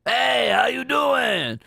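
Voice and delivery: hammed-up New Yorker, stretched out; bad New York accent. Streamer parroting the goblin in a bad New York accent. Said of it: "This is a bad accent".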